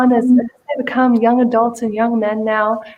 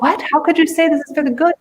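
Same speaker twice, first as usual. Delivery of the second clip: quick, high-pitched disbelief; storyteller's acted bafflement, rushed and higher; incredulous; 6 semitones higher, much faster than usual. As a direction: blurt it out, astonished. King reacts in disbelief to farmer.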